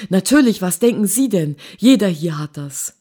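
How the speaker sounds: loud, emphatic comeback; impatient retort. A narrator's reading. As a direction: throw it back loudly, as if obvious. Featherston antwortet gereizt und laut. (laut und ungeduldig)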